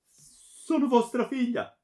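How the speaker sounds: very high, loud, slow cry; desperate appeal. A narrator's reading. Daughter reminding him of their bond, desperate. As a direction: wail it, imploring your father.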